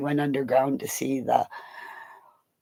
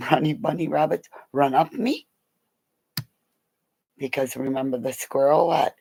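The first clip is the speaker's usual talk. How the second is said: ordinary talk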